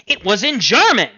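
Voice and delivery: mother scandalised by the German poems; indignant mother. Acted. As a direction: exclaim it in disbelief.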